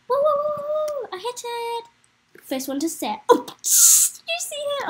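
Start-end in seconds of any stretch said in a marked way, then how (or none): 0.1-2.4 high, drawn-out whoops; celebratory squeal, stretched out; excited whooping. Real, not acted. Cheers hitting the bell in game. 3.3-4.9 high squeal of amusement; loud, drawn-out laughing outburst. Real, not acted. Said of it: "I shouldn't be laughing".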